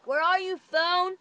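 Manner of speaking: high singsong calling. Real, not acted. Vlogger hunting for her phone, calling out. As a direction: call out to the lost thing.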